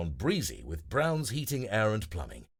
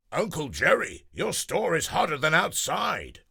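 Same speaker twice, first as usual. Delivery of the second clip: higher, louder whiny complaint; complaining friend; 7 semitones higher, 5 dB louder than usual. Acted. Wendell complaining to Uncle Jerry, higher and louder. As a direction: tease the shopkeeper about heat.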